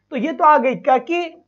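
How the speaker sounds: ordinary delivery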